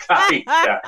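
said high through a laugh; laughing taita. Retold or imitated. Retelling his friend's joke, laughing it out. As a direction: repeat the nickname while cracking up. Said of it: "te decía".

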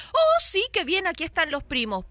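high-leaping, delighted "oh, sí"; mock an eager relative saying yes; gushing agreement. Relative agrees to keep the girls, mock gushing.

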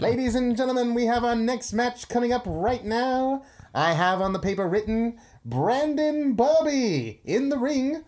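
high, slow, booming ring announcer. Acted. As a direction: hype the crowd like a fight announcer. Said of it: "I could just do my announcer voice".

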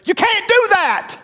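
sudden high, loud cry at the congregation; preaching shout. Real, not acted. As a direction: bark the warning out at full force.

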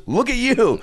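very high-pitched mock disbelief; imitated high, incredulous rant; incredulous scolding. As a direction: berate a friend in comic outrage. Acting Joel berating the young man.

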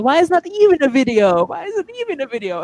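high-pitched mock complaint from a crowd; retold protest. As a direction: imitate everyone asking, voice climbing high. Group's protest retold in story.

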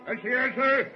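high, loud hail to the wheel; calling out. Acted. First mate hailing the man at the wheel, loud call. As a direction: call across the ship to the helmsman. Calling to helmsman across deck.

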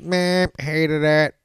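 slow, drawn-out bored shrug of a voice; bored mock dismissal. Mocking a lazy online critic, slow and bored. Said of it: "I don't just tweet".

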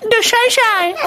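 very high falsetto yelp; falsetto yell. Shown as a demonstration. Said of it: "I yell out".

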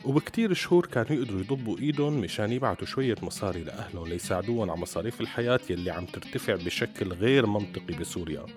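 usual speaking voice

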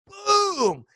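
high, drawn-out storytelling boom; dramatic sound-effect word at the climax; explosive boom. Retold or imitated. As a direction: burst out with the big impact. Climax of the story, sudden hit.

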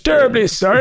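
high, well-spoken British action hero. Acted. Action hero politely asking for clothes, mock British accent. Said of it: "like a very British voice".

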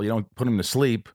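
ordinary delivery

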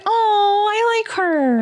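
high, drawn-out retold "aww" of instant liking; delighted reaction. Co-host replaying her reaction to a caller, high mock-swoon. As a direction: act out being charmed, pitched well up. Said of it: "Did you see the look on my face?".

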